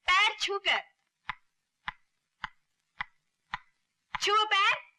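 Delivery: loud, high, forceful order; angry command. Acted. Woman making Ranjit touch the servant's feet, angry command. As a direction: raise the voice and demand obedience.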